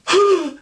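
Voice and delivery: high, sudden mock gasp; startled gasp. Girls react in startled gasp.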